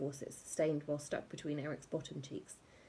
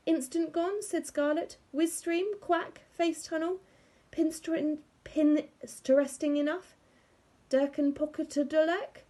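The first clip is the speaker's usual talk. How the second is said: much higher, proud listing voice; know-it-all little girl; 11 semitones higher, 4 dB louder than usual. A narrator's reading. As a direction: show off every name you know. Scarlett listing apps proudly.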